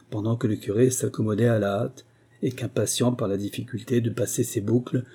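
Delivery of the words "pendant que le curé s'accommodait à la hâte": ordinary delivery, nothing marked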